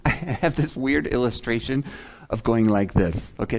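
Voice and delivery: ordinary talk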